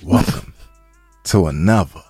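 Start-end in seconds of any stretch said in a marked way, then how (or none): none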